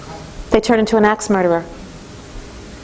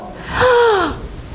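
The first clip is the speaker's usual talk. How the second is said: high sharp gasp of delight; mock delighted gasp; 13 semitones higher than usual. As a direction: gasp as if at a windfall. Parents freed of the babysitter.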